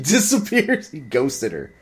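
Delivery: laughing, loud and high-pitched; amused disbelief. Real, not acted. Telling how his buddy ghosted her, laughing in disbelief. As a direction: burst out high through a laugh. Laughing at friend's sudden exit.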